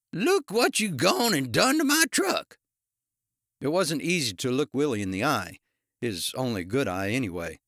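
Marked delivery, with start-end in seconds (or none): 0.1-2.6 high, loud, accusing character voice; yelled. A narrator's reading. Ice cream man to the officer who hit his truck, yelling. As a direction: yell it high, pitch leaping on the key words. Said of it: "he yelled, coming to his senses".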